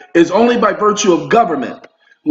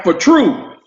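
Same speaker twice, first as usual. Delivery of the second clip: Southern saying; 4 semitones higher than usual. Retold or imitated. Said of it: "they say things like".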